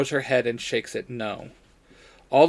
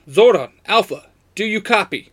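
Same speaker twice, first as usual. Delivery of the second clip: higher, louder, mildly panicked call; hint of panic; 7 semitones higher, 7 dB louder than usual. A narrator's reading. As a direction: call for contact with a touch of alarm. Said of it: "with a hint of panic".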